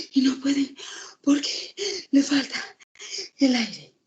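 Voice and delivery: jadeo actuado, agudo y entrecortado; sin aire. Acted. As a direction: habla como si llegaras corriendo, boqueando entre palabras. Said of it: "van corriendo y llegan a un lugar y quieren hablar". (imitando a alguien sofocado tras correr)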